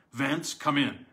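a loud command, weight on come; crying out. A narrator's reading. Wilde cries out to the crouching man, loud command. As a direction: call him in sharply. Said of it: "cried Mister Wilde".

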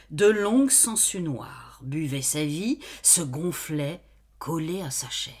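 plain reading voice

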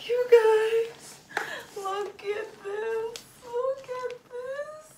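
high, slow, crying with delight; overwhelmed happy tears; choked-up squeal of joy; near tears with joy. Real, not acted. Overwhelmed by the gift.

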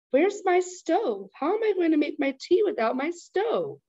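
fretful grandma missing her stove; distressed grandma. Acted. Grandma finds her stove gone.

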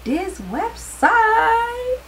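this website sung up high, drawn out slowly; squealed high and slow. Shown as a demonstration. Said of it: "I hope I'm pronouncing it right".

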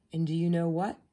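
usual speaking voice

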